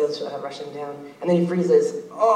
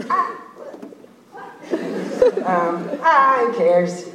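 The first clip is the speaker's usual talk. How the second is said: carefree character voice; 10 semitones higher, 8 dB louder, much slower than usual. Acted.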